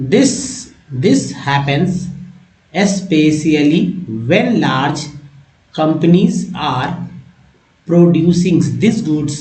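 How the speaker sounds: very slow, word by word, with long pauses; slow model reading. Shown as a demonstration. Teacher reads the English text slowly for his students. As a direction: read it out slowly so learners can follow each word. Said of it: "अब देखिए मैं पढ़ता हूँ।".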